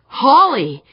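excited cry. A narrator's reading. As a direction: call her name out gladly. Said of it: "Cardenas cried".

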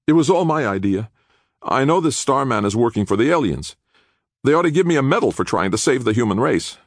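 put-on high-pitched, insistent young man; earnest young prisoner. Nick confessing to the attorney, earnestly. Nick's character voice in narration.

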